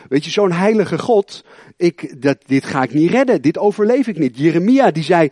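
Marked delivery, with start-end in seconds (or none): none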